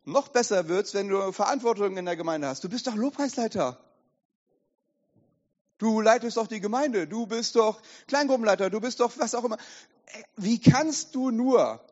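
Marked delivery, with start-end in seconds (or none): 2.6-9.6 raised, insistent reproach; accusing inner voice. Acted. Inner voice reminding a church leader of duties, insistent reproach. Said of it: "Diese Stimme setzt wieder an und sagt". 10.1-11.8 loud, high, drawn-out reproach; slow outraged cry of disbelief; accusing inner voice. Acted. Inner voice shaming a church leader, loud slow outrage. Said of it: "Diese Stimme setzt wieder an und sagt".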